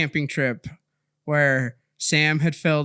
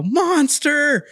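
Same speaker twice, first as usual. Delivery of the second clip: high, drawn-out mock yell; high-pitched "monster" holler, held back; imitated yell; 10 semitones higher than usual. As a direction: mimic a boy's battle cry at normal volume. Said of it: "I hear Sam yell".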